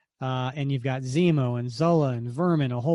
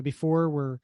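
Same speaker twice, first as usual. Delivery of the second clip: ordinary talk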